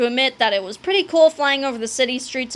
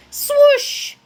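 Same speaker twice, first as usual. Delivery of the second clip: high, loud whoosh; swoosh sound effect; 6 dB louder than usual. A narrator's reading. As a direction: imitate a sudden swerve.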